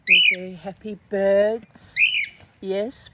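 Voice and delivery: plain reading voice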